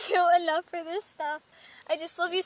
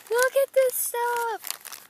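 high, slow, louder gushing over the gifts; thrilled; 7 semitones higher, 4 dB louder, slower than usual. Real, not acted. Pulling gifts from a friend's package, high happy squealing. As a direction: squeal with joy and laugh.

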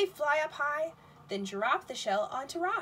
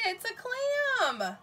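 higher, slow delighted reveal; excited reveal; 8 semitones higher, much slower than usual. Real, not acted. Teacher revealing the clam to kids, slow excited reveal. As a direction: unveil it with delight.

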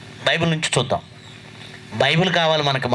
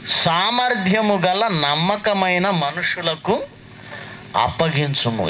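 slow, drawn-out Bible reading; measured recitation to a congregation; scripture reading; slower than usual. Real, not acted. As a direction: read the verse out slowly, word by word. Reads a scripture verse aloud.

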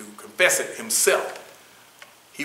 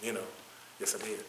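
guilty admission; 12 dB quieter than usual. Acted. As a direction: admit it under your breath, as an inner thought. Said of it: "to be thinking and feeling guilty".

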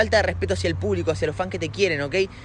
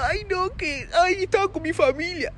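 much higher, whimpering mock sob voice; mock crying; 11 semitones higher than usual. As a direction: ham up a crybaby whine. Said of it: "venís a llorar". (llanto fingido y burlón, muy agudo)

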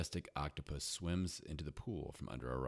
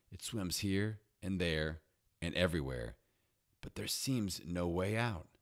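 plain reading voice

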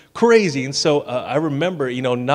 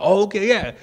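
higher-pitched imitation of girls agreeing; agreeable young girls; 7 semitones higher than usual. Dad imitating daughters accepting mom's game, high agreeable child voice. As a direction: answer lightly like little girls won over. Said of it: "Sadie and Sophie are like".

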